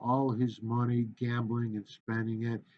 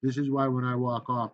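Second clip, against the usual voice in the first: usual speaking voice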